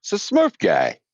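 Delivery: squeaky high cartoon voice, all the way up; high Smurf voice. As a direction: play the startled guy in a chipmunk squeak. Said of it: "I heard that voice".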